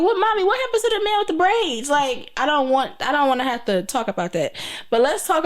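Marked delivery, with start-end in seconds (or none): 0.0-1.9 put-on a little girl asking mommy innocently; imitating her daughter. Mom voices her daughter asking about the man, high and childlike.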